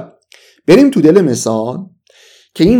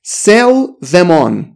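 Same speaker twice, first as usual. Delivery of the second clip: very slow English phrase; slow model reading; 3 semitones higher, much slower than usual. Shown as a demonstration. Teacher pointing out the phrasal verb, very slowly. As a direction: stretch each word out. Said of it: "یه نکته باحال دیگه".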